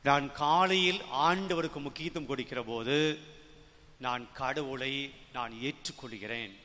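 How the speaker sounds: ordinary talk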